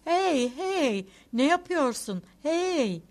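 high, loud calls to rouse someone; sudden shout. A narrator's reading. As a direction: shout "hey" to wake the driver, pitched well up. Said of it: "Çiçikov birden bağırdı".

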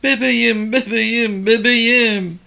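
very high, thin wail of a mother; high repeated cry for a lost baby; thin, cracking voice. A narrator's reading. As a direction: call out in a thin, high-pitched voice. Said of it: "İnce ses çatlıyordu".

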